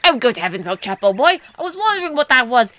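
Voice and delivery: put-on old-chap explorer voice; Colorado. Explorer wonders at a huge tuna, Colorado character voice. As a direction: play a fussy "old boy" explorer. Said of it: "your Colorado voice".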